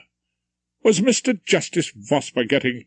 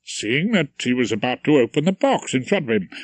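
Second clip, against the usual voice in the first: ordinary delivery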